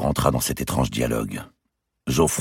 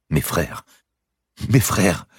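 stammering; 5 semitones higher, slower than usual. A narrator's reading. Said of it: "bredouilla le visiteur général".

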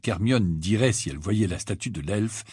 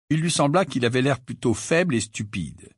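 ordinary delivery

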